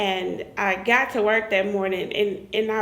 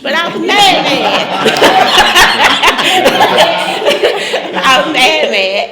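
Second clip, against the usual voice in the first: much louder, half laughing through the word mad; laughing about being mad; 3 semitones higher, 12 dB louder, much slower than usual. Retold or imitated. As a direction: loudly relive the anger, chuckling. Retelling her anger, laughing.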